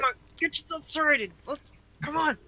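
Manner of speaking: urgent hushed urging. Acted. In-character urging in a mishap.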